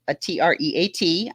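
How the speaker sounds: T R E A T spelled letter by letter. Shown as a demonstration. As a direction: spell out T R E A T, one letter at a time. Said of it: "I can't say the word".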